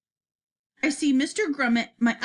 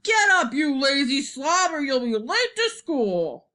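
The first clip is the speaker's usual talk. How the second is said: father's real voice. A narrator's reading. Father waking his lazy son for school, exasperated holler. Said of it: "hearing my father's real voice saying".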